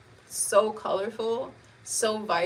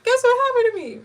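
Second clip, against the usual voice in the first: much higher and louder, eager to share the news; excited retelling; 14 semitones higher, 10 dB louder than usual. Retold or imitated. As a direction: burst out with the news like a kid sister. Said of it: "I don't care".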